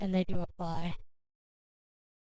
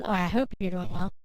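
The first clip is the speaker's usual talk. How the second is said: ordinary talk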